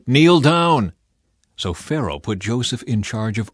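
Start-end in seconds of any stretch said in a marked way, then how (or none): 0.1-0.9 a loud, higher barked order; shouted command. A narrator's reading. Herald shouting at the crowd as Joseph rides past, loud command. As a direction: call it out so the whole street kneels. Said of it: "the command was shouted".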